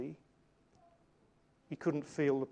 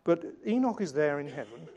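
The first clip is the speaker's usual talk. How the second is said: usual speaking voice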